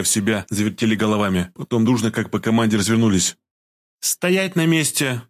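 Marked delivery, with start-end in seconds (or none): 4.0-5.2 slow, stern command; stern order. A narrator's reading. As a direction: halt them sternly. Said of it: "приказала Маша строгим тоном".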